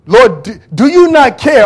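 high, loud indignant cry; upset Martha. Acted. Martha accusing Jesus, loud and indignant. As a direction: hurl the question like a wounded sister. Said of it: "this sister is upset".

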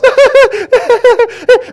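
high mocking laugh; high mock laugh at dirty jokes. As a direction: act out the crowd's laughter. Mimics people laughing at jokes.

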